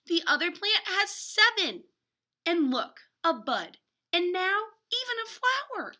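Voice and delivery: put-on reading to kids with growing delight; excited storytelling. A narrator's reading. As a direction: build wonder as the flower appears. Excited reveal in picture book.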